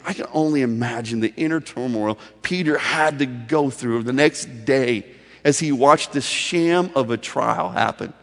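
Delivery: ordinary delivery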